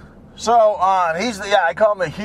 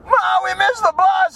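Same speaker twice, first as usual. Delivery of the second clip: high singsong mock child; kids calling Mom; 11 semitones higher than usual. Kids telling mother about the bus, high mock whine. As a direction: pitch it up like a complaining kid. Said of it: "they go".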